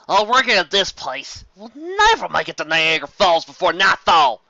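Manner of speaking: loud call over the downpour; crying out over rain. Acted. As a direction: shout it over the storm. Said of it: "Applejack cried above the noise of the rain".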